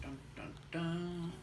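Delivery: sung "dun, dun, dun" like a film cue; playful spoof suspense sting; mock dramatic sting. Shown as a demonstration. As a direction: hum a spoof suspense chord.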